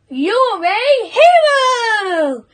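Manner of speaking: high, drawn-out cheer; admiring cry. A narrator's reading. Animals praising the cockerel, high drawn-out cry. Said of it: "cried all the animals".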